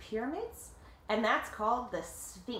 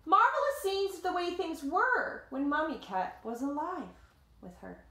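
lively read-aloud voice, higher than the chat; story narration; 6 semitones higher, 3 dB louder than usual. A narrator's reading. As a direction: tell it like a picture book to kids. Reading the picture book aloud.

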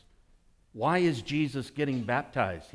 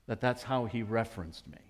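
ordinary talk

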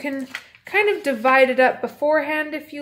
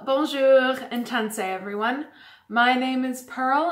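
usual speaking voice

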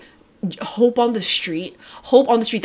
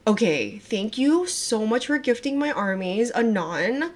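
ordinary talk

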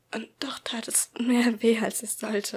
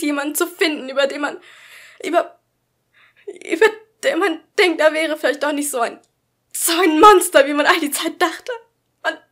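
rising, hurt anger, much higher and louder; building to a shout; 6 semitones higher, 10 dB louder than usual. A narrator's reading. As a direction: let it swell into a furious outburst. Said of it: "Meine Stimme war immer lauter geworden".

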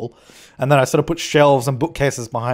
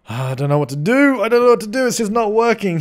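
mildly hammed-up exasperation, climbing higher; mock frustration; 7 semitones higher than usual. Builder stuck for ideas, in mock frustration. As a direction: let the pitch climb as if stuck.

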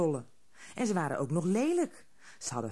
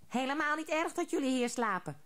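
higher, odd little troll voices together; troll chorus; 8 semitones higher, faster than usual. A narrator's reading. Troll crowd echoing the old troll, higher chorus voice. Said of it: "zeiden alle andere trollen tegelijk".

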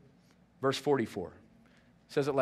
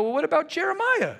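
much higher and louder, quick and eager; eager disciples; 11 semitones higher, 4 dB louder than usual. Retold or imitated. Disciples quizzing Jesus, high and eager. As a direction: keep pushing with the next question. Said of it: "And they go".

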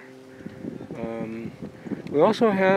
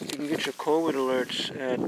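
ordinary talk, nothing marked

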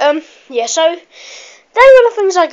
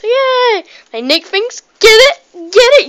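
mock cheer; 7 semitones higher, 5 dB louder than usual. Celebrating a bad joke.

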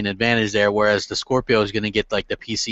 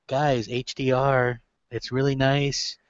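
quieter, slower nerdy presenter; soft slow presenter voice; 6 dB quieter, slower than usual. Retold or imitated. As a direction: mumble it like a shy engineer. Impression of Mark Cerny.

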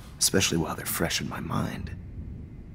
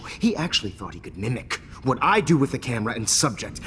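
usual speaking voice

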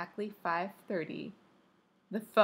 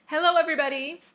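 high, loud cheerful greeting; ringing call; 9 semitones higher, 9 dB louder, faster than usual. A narrator's reading. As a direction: call it out up a stairwell. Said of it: "Stacy's voice rang up through the stairwell".

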